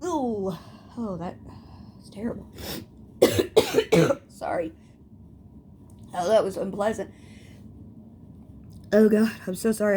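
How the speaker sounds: catching breath after coughing; breathless after a coughing fit. Real, not acted. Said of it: "I gotta catch my breath".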